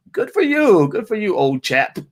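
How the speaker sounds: put-on high-pitched, half-hearted British accent; lightly mock-British congratulation; bad British accent. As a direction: attempt a jolly British "old chap". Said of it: "I can't do a British accent".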